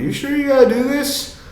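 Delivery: very high, squeaky questioning; mock nervous patient. Acting out his nervous teenage self.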